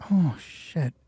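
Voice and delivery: normal talking voice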